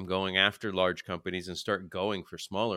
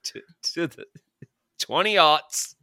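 wrestling announcer; 6 semitones higher, 5 dB louder, slower than usual. Pretend. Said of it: "pro wrestler announcement voice on".

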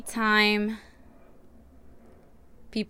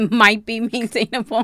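amused, raised-pitch teasing echo; laughing echo; 4 semitones higher, 6 dB louder than usual. Real, not acted. Woman echoing partner's wording back, laughing and louder. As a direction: repeat it louder and higher through laughter.

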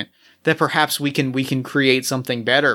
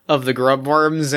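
ordinary delivery

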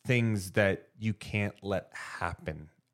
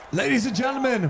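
high, booming stage announcer; concert announcer; 12 semitones higher, 3 dB louder than usual. Pretend. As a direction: hype the crowd like an MC. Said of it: "we have to get really in the scene".

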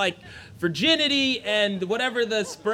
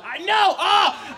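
high, loud lament; mock crying wail; 9 semitones higher, 7 dB louder, slower than usual. Boy burning card after card, mock crying wail. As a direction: bawl it theatrically over a lost treasure. Wails while burning cards.

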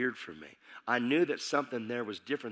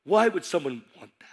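ordinary talk, nothing marked

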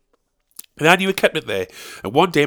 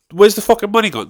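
outraged; 8 semitones higher, 6 dB louder than usual. Real, not acted. Outraged rant.

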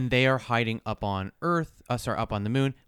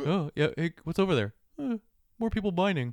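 light, high giraffe voice; shy airy giraffe; 8 semitones higher than usual. Pretend. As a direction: play a shy giraffe peering over walls. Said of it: "I love my giraffe voice".

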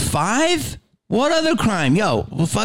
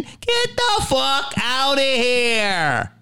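very slow, higher, scornful "Get the fuck outta here"; drawn-out disbelief; 8 semitones higher, much slower than usual. Acted. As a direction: stretch each word out in mocking disbelief. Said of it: "I didn't know nothing".

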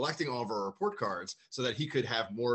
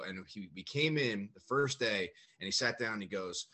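ordinary delivery

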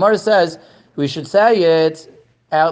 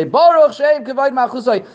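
raised, high prayer-leader call; higher-pitched imitation of a leader reciting aloud; imitated out-loud recitation; 8 semitones higher than usual. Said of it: "started saying it out loud".

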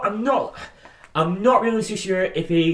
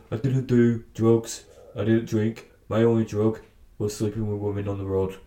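light impression, low and measured; slightly slowed, lowered voice with an attempted Canadian accent; Bret Hart; 4 semitones lower, 4 dB quieter than usual. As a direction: do a half-hearted impression of a wrestler confessing. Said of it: "best Bret Hart impression".

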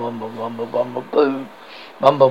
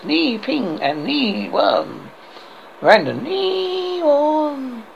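very slow, stretched incantation; drawn-out sacred words; 6 semitones higher, much slower than usual. Acted. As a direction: draw out each word like a holy chant. Knights announce their sacred words.

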